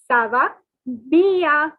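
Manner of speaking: "Ça va bien." slowly, bien as bia; slow French. Shown as a demonstration. Teacher modelling the reply, slow French. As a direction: stretch out "Ça va bien." with bien nasal. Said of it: "is pronounced as bien".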